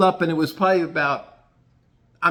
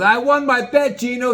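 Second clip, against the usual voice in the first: much higher, louder gloating crow; gloating Gratz; 10 semitones higher, 6 dB louder than usual. Retold or imitated. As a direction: crow over winning the bet. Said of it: "He goes".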